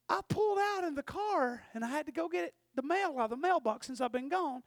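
mom questioning. Retold or imitated. Mother on the phone questioning her son about the dent. Mom voiced, suspicious then loud.

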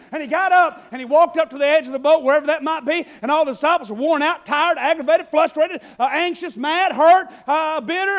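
loud, high, fiery sermon voice; full-force preaching. Acted. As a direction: preach it out to the back row.

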